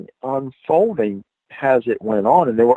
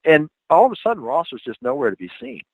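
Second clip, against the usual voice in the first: ordinary talk, nothing marked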